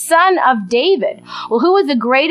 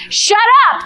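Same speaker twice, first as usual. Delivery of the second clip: high, snapped mock rebuke; 9 semitones higher than usual. Crowd scolding the blind beggar, sharp mock hush. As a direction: hush him sharply in jest.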